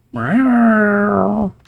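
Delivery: high, loud drawn-out meow for the kitten; cat meow. Acted. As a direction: mew like the little striped kitten. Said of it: "The striped kitten goes".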